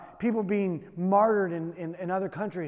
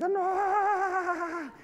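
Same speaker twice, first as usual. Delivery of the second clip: much higher mock voice of an exhausted wife; hammed-up higher wife's retort; imitating his wife; 11 semitones higher than usual. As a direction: snap back like a tired wife in labor. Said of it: "and he was like".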